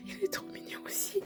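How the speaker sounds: soft unvoiced whisper of delight; a hushed coo; whispered gush. Real, not acted. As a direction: whisper it with wide-eyed awe. Hushed gushing at the figure.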